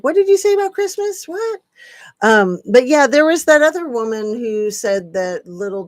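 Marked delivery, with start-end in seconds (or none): 0.0-1.6 high, fast, incredulous double take; mock disbelief. Misreading a viewer's comment, replaying her shocked reaction. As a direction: pop up high like you misheard something outrageous. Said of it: "I was like".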